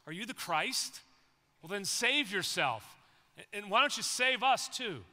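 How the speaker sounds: loud jeering taunt, rising; mocking insult. Criminal on the cross taunting Jesus, loud jeer. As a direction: sneer it at him loudly. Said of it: "he joins in on the insulting".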